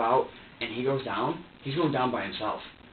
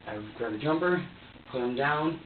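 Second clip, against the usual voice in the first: ordinary talk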